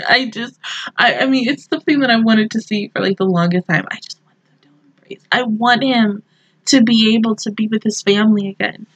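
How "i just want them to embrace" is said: very soft, hurried whisper; longing whisper. Real, not acted. Fan longing for the reunion, quick whisper. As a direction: whisper it quickly, like a private wish.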